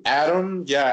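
usual speaking voice

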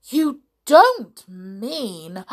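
dawning horror, each word drawn out; horrified disbelief. Acted. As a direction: falter loudly in shocked disbelief. Horrified by crocodile's hint.

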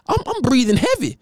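high, breathless player begging for a sub; exhausted player impression. Lineman wiped out in the first quarter, begging for a sub, high and breathless. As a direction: squeak it out like a gassed lineman pleading with the coach. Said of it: "My boy said".

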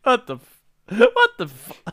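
rising to a high, loud squeal; shocked outburst. Real, not acted. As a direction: cut off in disbelief.

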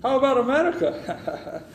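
imitating Indian call center accent. Scammer asking the caller, in mock Indian accent. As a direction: play the scammer's sing-song Indian accent.

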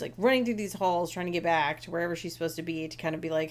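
normal talking voice

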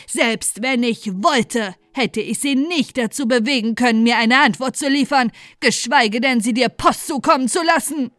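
put-on loud, angry old woman voice; angry scolding. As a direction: scold him hard and loud. Grandmother scolds Joseph angrily.

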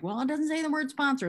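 high-pitched mock quibbling; mimicked objector. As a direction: imitate a nitpicker raising an objection.

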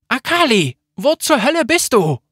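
high, loud call across the ruins. A narrator's reading. Sarah searching the ruined city, yelling loudly for Akali. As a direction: shout her name into the rubble.

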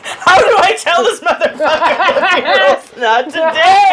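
giggly mock despair; laughing exasperation. Asking herself how to tell her husband, laughing. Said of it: "And I'm like".

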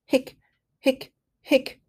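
high squeaky hiccups. Acted. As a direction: hiccup in quick bursts. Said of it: "I have the hiccups".

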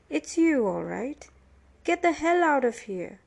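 irate prisoner throwing someone out; angry accusation. A narrator's reading. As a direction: drive him off angrily. Prisoner drives Billy away.

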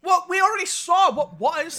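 loud, high indignant retort; heated, insistent objection; worked up. Real, not acted. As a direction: protest with real exasperation. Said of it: "I'm getting really worked up".

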